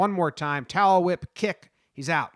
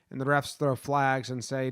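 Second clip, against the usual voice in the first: normal talking voice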